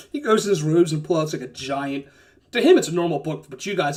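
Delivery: ordinary talk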